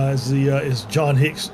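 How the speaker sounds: normal talking voice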